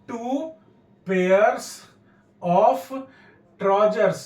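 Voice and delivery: pauses after Two, pairs and of, falling on trousers; word-by-word dictation. Shown as a demonstration. Teacher dictating what to write, one word at a time. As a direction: isolate each word, punching pairs and of.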